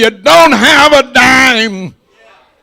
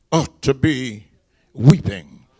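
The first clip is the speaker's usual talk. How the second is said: ordinary talk, nothing marked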